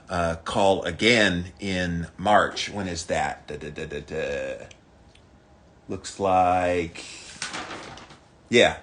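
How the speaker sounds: normal talking voice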